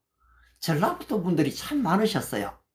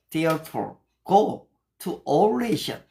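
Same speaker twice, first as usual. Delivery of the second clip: slow, deliberate English verse recital; measured English Bible reading; scripture recitation; much slower than usual. Shown as a demonstration. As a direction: recite each word slowly like memorized scripture.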